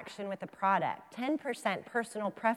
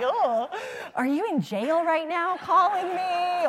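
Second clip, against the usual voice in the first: laughing joke; 8 semitones higher, 7 dB louder than usual. Real, not acted. Mock joke question, laughing.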